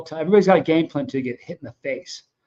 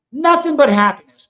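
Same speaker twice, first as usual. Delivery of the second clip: bright cheerful burst; 9 semitones higher, 8 dB louder than usual. Real, not acted. Cheerful sign-off promise.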